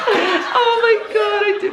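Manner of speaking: squealing laugh; high laughing. Real, not acted. Laughing at own joke.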